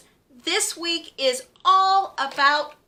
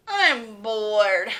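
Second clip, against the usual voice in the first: lower, a mild grumble; lightly voiced bored grumble; grumbled; 3 semitones lower, 3 dB louder than usual. Ruby waiting outside, a mild bored grumble. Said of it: "grumbled Ruby".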